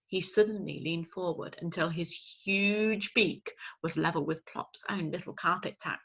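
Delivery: plain reading voice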